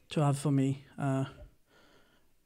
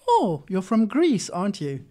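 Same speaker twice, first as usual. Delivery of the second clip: imitating a taxi driver; 5 semitones higher, 6 dB louder than usual. Uber drivers asking where he is from, hammed-up chatty guess.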